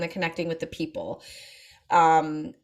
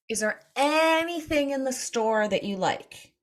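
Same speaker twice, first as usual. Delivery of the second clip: put-on slow, higher-pitched prompting of an elderly grandfather; coaxing question; 6 semitones higher than usual. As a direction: stretch the question out slowly and higher, to coax an answer.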